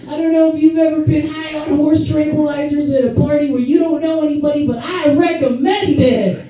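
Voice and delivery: high, bright salesman voice; mock ad pitch. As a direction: pitch it like an enthusiastic infomercial.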